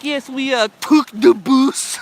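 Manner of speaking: high, loud, exaggerated French accent; strong French accent. Imitating a documentary lady talking about the bus, strong French accent. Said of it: "she has this really strong French accent".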